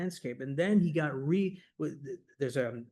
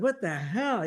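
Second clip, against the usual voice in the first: a higher, louder what the hell; incredulous; 7 semitones higher, 4 dB louder than usual. Retold or imitated. Speaker reacting to the government memo, higher, incredulous. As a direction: throw up your hands in disbelief. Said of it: "That's what I was like".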